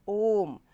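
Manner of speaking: ओम with a greatly stretched o; extra-long vowel. Shown as a demonstration. Teacher giving an example of a triple-length vowel, very prolonged. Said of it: "तिगुना".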